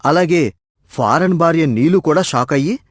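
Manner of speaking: plain reading voice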